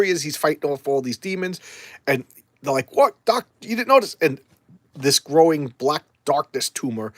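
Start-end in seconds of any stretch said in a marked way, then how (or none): 2.9-4.2 high, loud mocking question; high-pitched teasing jab; mock taunt. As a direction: needle him in a raised pitch. Acting out the demons' taunt.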